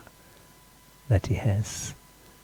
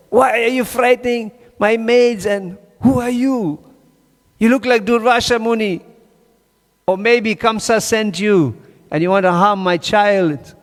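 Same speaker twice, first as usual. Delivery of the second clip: much higher, voiced as an alarmed mother; Mother Yashoda protective; 11 semitones higher than usual. Mother Yashoda confronts the strange sadhu, high alarmed mother voice. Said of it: "and she said".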